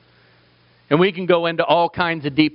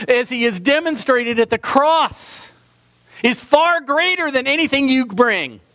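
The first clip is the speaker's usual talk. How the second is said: preaching at full force; 8 semitones higher than usual. Acted. Preacher urging sinners toward God's mercy, full-force preaching. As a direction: lift the whole room with a pleading sermon voice.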